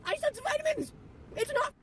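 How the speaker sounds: very high squeaky voice for her body; excited body voice. Pretend. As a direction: squeal like a thrilled inner voice. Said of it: "my body's like".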